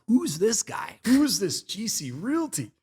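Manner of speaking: high, drawn-out bewildered inner voice; mock puzzled self. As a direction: play your younger self baffled by a stranger. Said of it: "And I was like".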